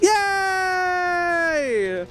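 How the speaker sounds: excited yay. Real, not acted. Cheering at the ending.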